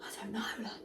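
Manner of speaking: airy whispered reply, soft; breathless whisper. Retold or imitated. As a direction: whisper it as if short of air. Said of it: "out of breath".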